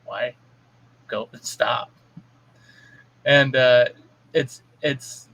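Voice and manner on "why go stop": low, hushed retold reproof; unimpressed parent. Parent answering a temper tantrum, quietly unimpressed. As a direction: play it unmoved and very soft.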